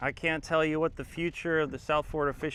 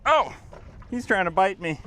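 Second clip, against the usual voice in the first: surprised; 4 semitones higher, 4 dB louder than usual. Real, not acted. Fish snapping at him.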